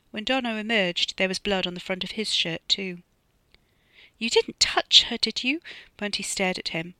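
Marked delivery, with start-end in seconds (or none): 4.2-5.6 horrified high question. Acted. Bunty horrified.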